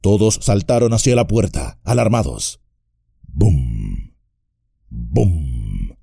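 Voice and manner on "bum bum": low, booming voiced drumbeat; deep drum boom. A narrator's reading. Narrator booming out the drums of Moria, deep and distant. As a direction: boom it out like a far-off war drum. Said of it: "resonó otra vez".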